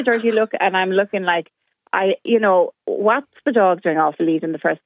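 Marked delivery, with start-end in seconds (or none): none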